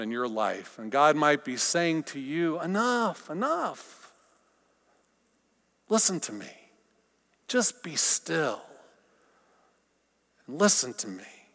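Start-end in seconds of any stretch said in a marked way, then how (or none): 2.6-11.5 soft, tender reassurance; calm a worried person; gentle. Acted. God speaking gently to you.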